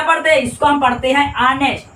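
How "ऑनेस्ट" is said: "ऑनेस्ट" starting straight on the vowel; correct silent H. Shown as a demonstration. Teacher answering how it is read, "ऑनेस्ट" with silent H. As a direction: say "ऑनेस्ट" without any H. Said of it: "silent हो जाएगा".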